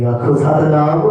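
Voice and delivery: loud, slow call of Satnam; call to congregation. Real, not acted. Preacher calling the congregation to say Satnam. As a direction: lead the crowd in the chant.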